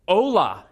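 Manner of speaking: "Hola." said slowly, each sound spelled out carefully; slow careful enunciation. Retold or imitated. Little daughter greeting the Japanese family, slow and over-enunciated. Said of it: "slowly and really enunciating her word".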